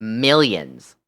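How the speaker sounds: ordinary talk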